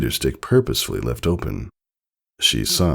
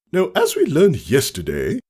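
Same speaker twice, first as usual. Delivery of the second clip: teacher voice; 11 semitones higher than usual. Acted. Teacher starting lesson.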